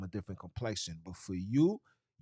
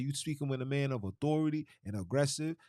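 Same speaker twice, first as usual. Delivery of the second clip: usual speaking voice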